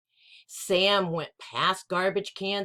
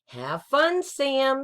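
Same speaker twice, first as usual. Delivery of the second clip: lightly voiced mother; 7 semitones higher, 4 dB louder, slower than usual. Mother Joan voiced in story.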